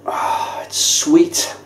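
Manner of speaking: breathy whisper over the aroma; whispering after sniffing the beer; whispered savouring. Real, not acted.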